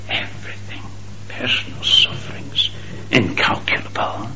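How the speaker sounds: sudden whisper after the climax; hushed whisper. Acted. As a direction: drop to a breath.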